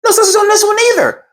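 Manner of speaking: high-pitched imitated wife; retold incredulous report; dismayed discovery. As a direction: announce the bad news way up high. Wife reports second missing sausage.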